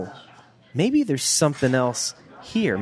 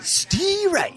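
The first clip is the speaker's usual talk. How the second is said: much higher, cartoonish; high silly voice. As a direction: squeak the name out. Comic high far voice.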